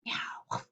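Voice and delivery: whispered meow. Acted. Cat sound effect.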